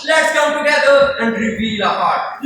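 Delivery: normal talking voice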